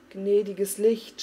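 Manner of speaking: a slow, loud cry of horror; cried out. A narrator's reading. Farik seeing the men die, crying out slowly and loudly. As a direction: call out to the Light in alarm. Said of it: "schrie Farik".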